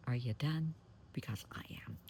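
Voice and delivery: soft, unbothered, dropping to a whisper at the end; cool dismissal. Pretend. To someone yelling at you, calm and dismissive. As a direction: let the last words vanish into a near whisper. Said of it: "and just say".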